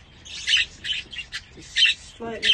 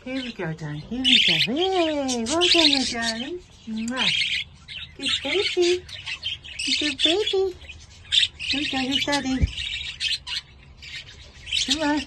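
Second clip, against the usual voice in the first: cooing pet talk; 6 semitones higher than usual. Real, not acted. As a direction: coo at the little one like a proud parent. Cooing to pet birds at feeding.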